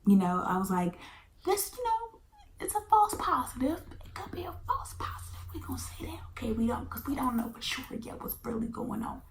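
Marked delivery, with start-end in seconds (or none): 1.4-3.8 high, insistent self-reassurance; high-pitched in denial. Retold or imitated. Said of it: "I was still in denial". 4.0-9.2 whispered high and hushed; hushed pleading whisper; whispered denial. Retold or imitated. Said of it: "I was still in denial".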